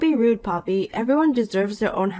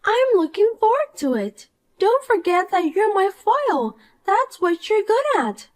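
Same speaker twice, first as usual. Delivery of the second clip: put-on bright, higher voice of a smug mean girl; snide rich girl; 11 semitones higher than usual. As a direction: talk down to her with sweet contempt. Poppy gloats.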